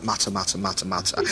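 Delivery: muttering like a complaining caller; gossiping mutter. Retold or imitated. Mimicking church gossips on the phone, mock muttering. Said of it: "When the phones start going".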